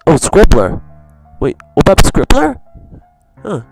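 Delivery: usual speaking voice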